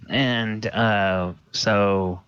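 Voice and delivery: normal talking voice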